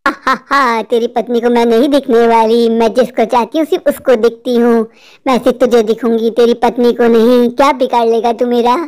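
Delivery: deep, deliberate "ha ha ha", then taunting; mock him in a very low witch voice; low-pitched, monotone witch's gloat.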